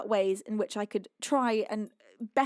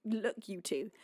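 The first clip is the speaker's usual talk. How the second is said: usual speaking voice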